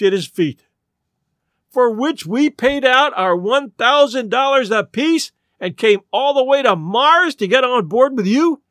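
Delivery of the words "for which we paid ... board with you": indignant protest. A narrator's reading. Cheated old lady confronting the showman, indignant. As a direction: press each grievance home. Appalled protest about the rocket.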